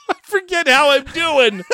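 high, slow, laughing through words. Real, not acted. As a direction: giggle the whole line out. Laughing at the running joke.

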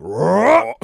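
playful rising roar; zombie roar. Retold or imitated. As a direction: imitate a zombie roaring back.

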